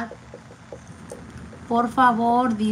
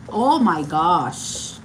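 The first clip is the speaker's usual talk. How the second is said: stunned surprise; 6 semitones lower, much slower than usual. Real, not acted. Winner drawn, host reacts.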